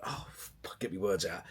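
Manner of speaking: real hushed self-reproach; frustrated muttering. Real, not acted. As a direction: mutter under your breath, stuck for words. Losing his words mid-explanation.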